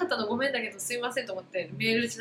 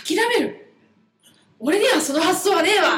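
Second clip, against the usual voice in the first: higher, louder mock shout from the boss; loud retold yell; 6 semitones higher, 8 dB louder than usual. Retelling how the boss shouted at her in front of everyone, loud mock shout. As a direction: belt out the boss's scornful disbelief. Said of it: "大声で言われる".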